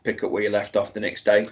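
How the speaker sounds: ordinary talk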